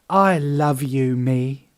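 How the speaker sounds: mock self-love. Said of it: "love affair with the self".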